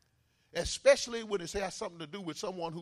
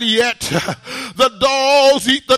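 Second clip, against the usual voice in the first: loud, rhythmic sermon delivery with uh breaths; fiery preaching; 4 semitones higher, 20 dB louder than usual. Acted. Preacher urging the church to call on God, fiery preaching. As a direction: preach it hard to the congregation.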